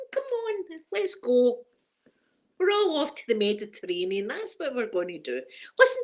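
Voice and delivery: high, bubbly daydream of winning the lottery; giddy excitement. Pretend. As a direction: invite everyone along in a burst of glee. Lottery cruise fantasy to viewers.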